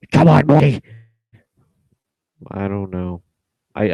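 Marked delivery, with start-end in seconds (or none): 0.1-0.8 rough cartoon scientist voice, loud and high; Rick impression. Trying a Rick voice, pushed loud like a metal vocalist. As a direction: growl it like a grumpy mad scientist. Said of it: "a good Rick impression".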